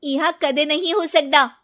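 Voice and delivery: high scoffing hum, then loud refusal; scornful dismissal. Acted. Bride scoffs at mother-in-law's hope, loud and scornful. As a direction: sneer it off, never.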